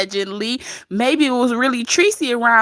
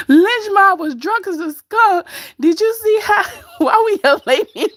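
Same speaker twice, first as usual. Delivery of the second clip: laughing; 9 semitones higher than usual. Real, not acted. Laughing about drunk Lynch Mob.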